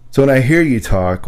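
ordinary delivery

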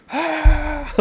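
high, drawn-out "aah"; mock battle yell. Imitating Rambo on a rampage, high mock yell. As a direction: let out a held, high-pitched mock cry. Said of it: "it's just like".

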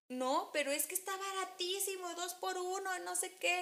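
high, pushy hard sell; eager brother voice. Retold or imitated. Brother pushing the two-for-one doughnut deal, high and eager. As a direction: squeak it out like a brother pushing a bargain. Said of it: "Me dijo".

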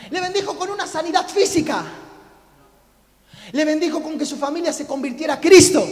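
high, loud rhetorical challenge; impassioned, escalating pulpit shout; full-force preaching. Real, not acted. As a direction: fire each question at the congregation.

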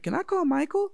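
put-on six-year-old begging to call his best friend; little kid voice.

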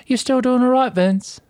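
high, bright check-in over the intercom; chirpy nurse voice. Retold or imitated. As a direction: play the cheerful technician. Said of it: "the lady from the place ... goes".

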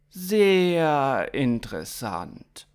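lingering on each word; hesitant reaction. Acted. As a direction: stretch the words after a hesitation. Hesitant reply to alien's odd errand.

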